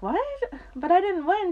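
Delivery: high, puzzled protest; disbelief. Retold or imitated. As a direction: question the good news in disbelief. Acts out her disbelieving reply.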